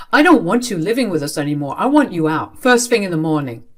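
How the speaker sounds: loud, firm, unyielding order; angry ultimatum. Acted. Father kicking child out, angry ultimatum. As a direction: lay down the ultimatum loudly.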